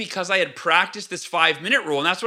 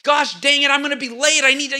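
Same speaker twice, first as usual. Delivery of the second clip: higher, louder, exasperated grumble in traffic; frustrated outburst; 8 semitones higher, 3 dB louder than usual. Retold or imitated. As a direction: fume at being stuck and late. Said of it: "I'd get all upset".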